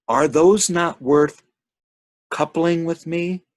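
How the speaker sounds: high, slow, pleading temptress; tempting Salome. Acted. Salome tempting the visitor to couple with her, slow and high. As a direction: draw him in with a slow high plea.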